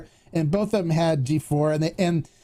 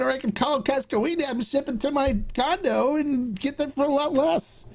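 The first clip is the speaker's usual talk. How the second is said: indignant retort; 8 semitones higher than usual. Retold or imitated. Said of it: "And I go".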